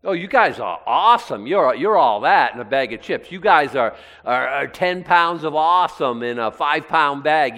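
loud, over-the-top mock flattery; sarcastic. Preacher paraphrasing Paul to puffed-up believers, sarcastic. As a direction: gush with praise you plainly don't mean. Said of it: "He's, he's being sarcastic".

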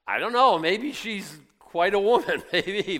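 loud, high and slow mock disbelief, then a laugh; incredulous. Preacher to his congregation, scoffing loudly in mock disbelief. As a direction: scoff at the idea, half laughing. Mock doubt about Mary carrying him.

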